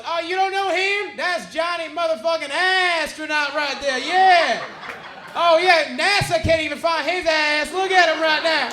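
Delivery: high, booming mock introduction; Ralphie. Headliner shows off the stoned comic to a radio host, loud high jeering. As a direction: imitate a whooping, full-volume roast. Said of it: "that's when Ralphie goes".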